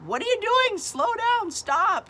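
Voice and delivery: high, loud panicked yell; reenacted frantic screaming; retold scream. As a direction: scream at the driver to stop. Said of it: "And I'm screaming".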